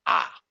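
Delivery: loud echoing shout from the mountain; short, forceful mimicked yell; echoed cry. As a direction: throw back a sudden loud "ah". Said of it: "he hears the voice repeating".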